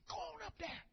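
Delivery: soft whispered aside; whispering to neighbour. Retold or imitated. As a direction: lean in and whisper the question. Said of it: "he said".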